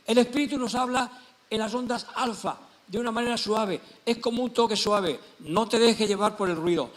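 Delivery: loud, high, exhorting preacher; emphatic sermon voice, each phrase driven home; full-force preaching. Acted. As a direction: proclaim it to the congregation with force. Preaches as the Spirit speaking.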